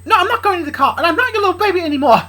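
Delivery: high, loud tirade building to a scream; defiant yelling. Acted. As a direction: shout your defiance, climbing to a shriek. Luna defies her father, quarrelling.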